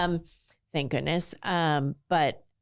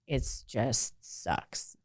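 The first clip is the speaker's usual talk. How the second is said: softer, voice dropping away in despair; retold crying; 4 semitones lower, 6 dB quieter, much slower than usual. Telling girlfriends her life is miserable, soft tearful drop. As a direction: let it die into a tearful hush. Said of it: "as I'm sitting there crying".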